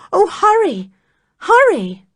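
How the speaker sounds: high, loud urgent cry; urgent plea. A narrator's reading. Narrator to the listener, an urgent high cry. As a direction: urge him on.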